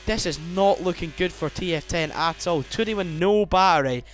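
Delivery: rising excited race call; motorsport commentator. Acted. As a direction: build the excitement lap by lap. Live race play-by-play.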